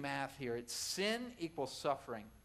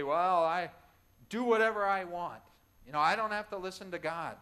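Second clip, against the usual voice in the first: defiant quote; 8 dB louder than usual. Retold or imitated. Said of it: "there's a lot of people who say".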